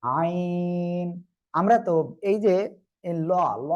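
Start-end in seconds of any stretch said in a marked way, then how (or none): 0.0-1.1 আইন drawn out slowly from a pressed throat; correct throat-pressed ain. Shown as a demonstration. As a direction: squeeze the throat and hold আইন long. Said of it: "গলাটা একটু চেপে উচ্চারণ করতে হবে".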